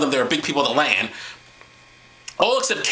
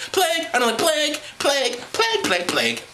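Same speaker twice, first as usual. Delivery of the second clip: higher, whiny repeated plague; mock whine; 10 semitones higher than usual. Mocking the word plague.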